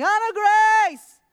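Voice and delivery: a father yelling his lost daughter's name, high and loud; desperate shout. Retold or imitated. Father searching streets, shouting for his missing daughter. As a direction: call out frantically across the street, drawn out. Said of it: "I start shouting".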